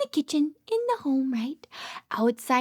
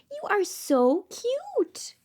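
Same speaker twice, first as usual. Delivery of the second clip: ordinary talk, nothing marked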